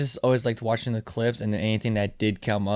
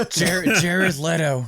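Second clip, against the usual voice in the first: laughing; 7 semitones higher, much slower than usual. Real, not acted. Remembering name, laughing.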